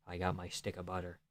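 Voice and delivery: ordinary delivery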